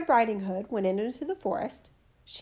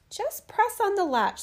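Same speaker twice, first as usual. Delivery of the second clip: high, slow feeble granny; old grandmother voice; 9 semitones higher than usual. A narrator's reading. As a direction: speak as a weak old grandmother, slowly. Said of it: "said the grandmother".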